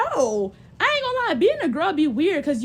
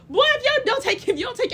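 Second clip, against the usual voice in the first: high telling-off; 11 semitones higher than usual. Pretend. Telling off a nasty dude.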